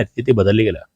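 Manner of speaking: ordinary talk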